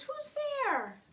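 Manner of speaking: high frightened little squeak; mouse crying out. Acted. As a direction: call into the dark like a startled mouse. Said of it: "Mouse cries".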